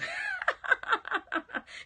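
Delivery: loud, high peal of laughter; real high cackling laugh; big laugh. Real, not acted. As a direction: burst out laughing at your own joke. Laughs at her own joke.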